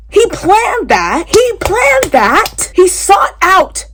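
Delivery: rising outrage; outraged. Real, not acted. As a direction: spit out the realisation in disgust. Outraged at the villain.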